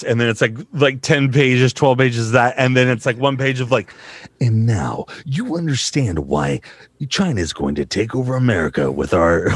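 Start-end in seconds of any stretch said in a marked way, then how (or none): 4.4-9.5 low documentary-trailer narration; mock-epic voiceover opening; epic narrator. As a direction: intone it grandly, deep and measured. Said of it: "an epic thing at the beginning".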